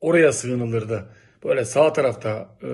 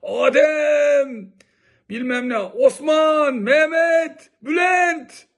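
imitating a stadium shout; 10 semitones higher, much slower than usual. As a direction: mimic the cheerleader yelling names from the terraces. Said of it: "Ne bağırırdı be". (taklit ederek, tribünden bağıran lider gibi)

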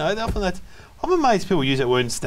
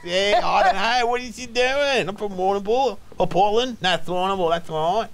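a hint of the other Dave, slowish and higher; imitating Dave Hughes; 6 semitones higher than usual. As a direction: lightly put on the other Dave's voice. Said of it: "Then I have to go".

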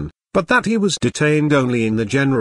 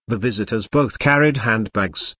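plain reading voice

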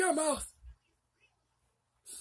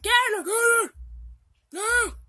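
louder angry shout; furious grandpa, much louder; shouted order; 2 semitones higher, 6 dB louder than usual. Pretend. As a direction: bark the order at her.

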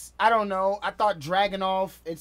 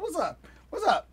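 ordinary talk, nothing marked

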